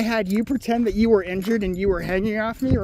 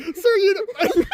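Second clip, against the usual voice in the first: laughing; 10 semitones higher, much slower than usual. Retold or imitated. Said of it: "My mom was like".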